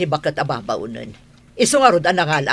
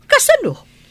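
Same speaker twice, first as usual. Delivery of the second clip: stunned question; 3 dB louder than usual. Acted. Wife stunned by accusation.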